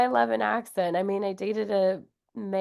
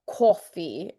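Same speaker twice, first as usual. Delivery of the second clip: "coffee" as "cawfee", New York style; slow, rounded New York vowel in "coffee"; thick New York accent; 2 semitones lower than usual. Shown as a demonstration. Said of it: "a thick New York accent is very".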